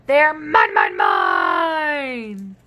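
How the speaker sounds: a dino snatching blocks; greedy shout. Acted.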